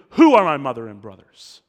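Jesus booming the question to jar the crowd; a loud pointed question. Acted. Preacher voicing Jesus to the crowd, loud and pointed. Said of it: "He's going".

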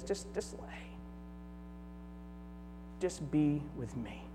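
near-whispered, tender coaxing; hushed and soothing, as God's imagined voice; gentle invitation. Acted. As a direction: softly invite someone to rest, voice almost gone. Voicing God's gentle invitation to rest.

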